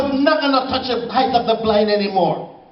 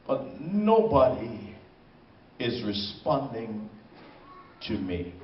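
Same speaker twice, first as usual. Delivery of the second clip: fading into silence; 12 semitones lower, 10 dB quieter than usual. Acted. Voice of victim trapped in fire.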